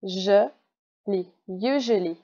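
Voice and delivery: slow teacher's demonstration of "usually"; syllables then whole word. Shown as a demonstration. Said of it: "commencer mon mot par you".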